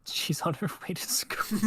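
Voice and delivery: breathy hushed repeat, half teasing; mock ominous whisper. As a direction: whisper it as a dark joke.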